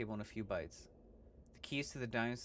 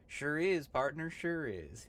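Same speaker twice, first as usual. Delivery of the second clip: loud hammed-up Western drawl; cowboy voice; 5 semitones higher, 4 dB louder, slower than usual. Gabby greeting a stranger, loud hammy cowboy. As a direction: holler like an old prospector.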